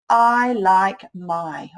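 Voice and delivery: very slow, word by word; drawn-out reading aloud; slow sounding out. Shown as a demonstration. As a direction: read each word slowly while writing.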